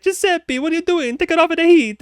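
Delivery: high frantic Italian chef. Pretend. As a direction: squeal it like a panicked Italian chef. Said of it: "what they would sound like back in the kitchen".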